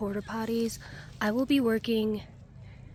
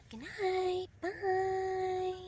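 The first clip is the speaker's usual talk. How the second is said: sing-song high goodbye; high sign-off; 10 semitones higher, much slower than usual. Real, not acted. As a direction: chirp a high good night. Signing off cheerfully.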